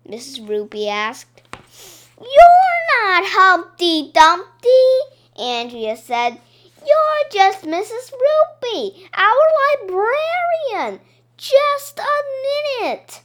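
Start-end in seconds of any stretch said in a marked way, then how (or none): 2.2-4.9 put-on higher, louder, slow accusing girl voice; a girl calling out a grown-up's disguise, slow and loud; emphatic higher child's voice; Andrea accusing. 6.8-13.2 put-on much higher, slow insistent girl voice; higher girl's voice, stubborn and slow; insistent Andrea. Andrea insisting she's the librarian.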